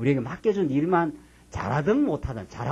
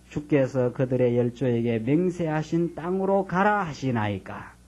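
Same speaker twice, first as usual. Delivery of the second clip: plain scripture reading; 3 semitones higher than usual. Acted. Reads verse twelve.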